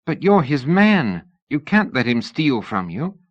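put-on young lady Sybil, anxious. A narrator's reading. Narrator voices Sybil.

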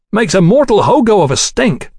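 high-pitched grumble over the noise; complaining. A narrator's reading. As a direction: complain with the voice pushed up. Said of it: "Tom complained".

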